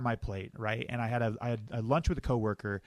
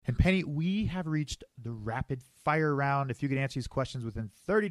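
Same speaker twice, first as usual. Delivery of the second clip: announcer; 3 semitones higher, 4 dB louder than usual. Acted. Host announces the rapid fire round.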